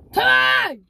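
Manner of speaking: loud call across the room. Real, not acted. Kid calling out to the toy Timmy, loud call. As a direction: shout the name to get attention.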